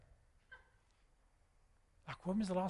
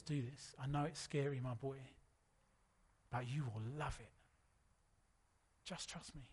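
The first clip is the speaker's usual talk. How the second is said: gentle reassurance; 8 dB quieter than usual. Retold or imitated. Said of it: "And when he says".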